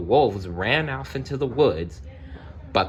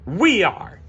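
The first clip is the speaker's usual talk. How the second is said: villagers' shout; 12 semitones higher, 4 dB louder than usual. Acted. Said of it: "answered the villagers".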